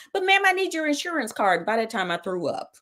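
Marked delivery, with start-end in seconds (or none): 0.1-1.6 higher, put-on voice of a bored clerk; high mocking voice of the desk girl; mock receptionist. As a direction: mimic her indifference. Mimicking the receptionist again.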